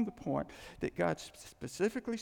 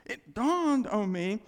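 ordinary talk, nothing marked

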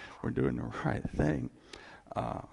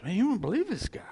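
higher, eager "can you believe it"; excited report; 8 semitones higher, faster than usual. Retold or imitated. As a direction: rave about him to a friend. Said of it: "other believers are telling John".

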